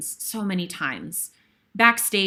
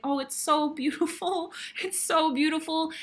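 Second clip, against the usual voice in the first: high, soft retold wonder; moved, awed; 7 semitones higher, 5 dB quieter than usual. Performer watching dancers from the side, softly awed. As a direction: marvel in a small, high, softened voice.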